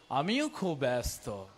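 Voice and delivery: low, soft voice of the Lord; voice of God. Retold or imitated. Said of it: "প্রভু বলবে".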